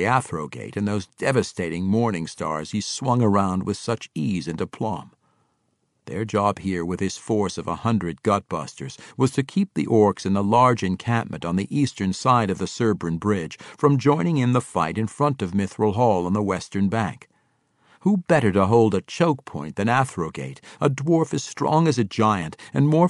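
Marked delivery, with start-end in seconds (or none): none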